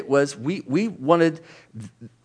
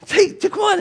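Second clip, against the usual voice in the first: pleading sitter; 9 semitones higher, 3 dB louder than usual. Retold or imitated.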